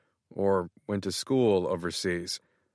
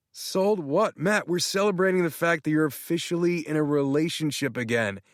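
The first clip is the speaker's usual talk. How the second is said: much higher, gushing excited friend; excited Tanner; 7 semitones higher than usual. Acted. Tanner thrilled, congratulating Matt on being in love. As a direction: gush as if he just found out. Tanner confused then celebrating.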